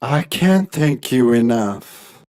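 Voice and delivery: low, slow, grateful voice; deep, measured thanks; humble gratitude. Acted. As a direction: give thanks slowly and low, bowing to royalty. Young man bows before the king.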